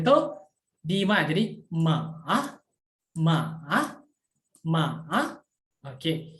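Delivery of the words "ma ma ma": MA dipping down, then rising back up; MA with a tick-shaped pitch, down then up; third tone MA. Shown as a demonstration. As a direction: drop MA low and lift its tail. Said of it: "atas turun ke bawah terus naik lagi".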